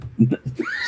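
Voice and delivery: very high shrieking laugh burst. Real, not acted. Cracking up at his own joke with a shriek. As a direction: let out a squealing shout of laughter.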